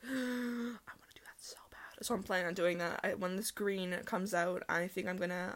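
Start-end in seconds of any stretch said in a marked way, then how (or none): 0.0-2.1 slow, soft whispered longing; hushed, yearning whisper; breathless whisper. Retold or imitated. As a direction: whisper it like a secret wish.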